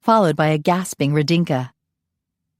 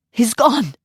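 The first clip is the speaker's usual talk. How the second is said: raised, urgent alarm; alarmed cry; 7 semitones higher than usual. Acted. Nanny bursting into the party with alarm. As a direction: burst in with the news.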